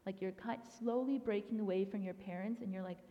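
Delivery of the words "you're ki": ordinary delivery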